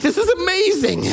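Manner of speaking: high, thrilled exclamation; excited disbelief. Retold or imitated. Pastor on his porch, thrilled by the neighbor's plea, exclaiming high. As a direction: pitch it up in delighted shock. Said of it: "I'm like".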